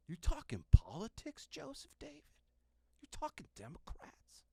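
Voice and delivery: high, squeaky incredulous asking; high mock-shocked questions; mock disbelief. Co-host mock-scolding the host.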